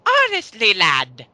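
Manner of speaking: high, loud mock Irish read; reading her comment in a silly high Irish voice. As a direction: ham up the "lad" like a stage Irishman. Reads comment in mocking voice.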